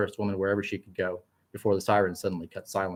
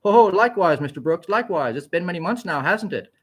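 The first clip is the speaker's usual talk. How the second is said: higher, bouncy, almost Swedish-sounding fellow; happy light voice; 8 semitones higher, 4 dB louder than usual. A narrator's reading. Doctor Carl greets Onyx, happy, light and higher. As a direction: chirp it like a cheerful young doctor. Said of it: "in a happy and light and almost Swedish-sounding voice".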